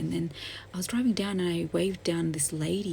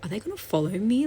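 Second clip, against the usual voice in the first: higher, louder worried question, mildly acted; retold alarm; 5 semitones higher, 5 dB louder, faster than usual. Woman recalling being followed as she walked away, mildly scared. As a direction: retell a moment of mild panic, pitch lifted. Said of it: "I got scared".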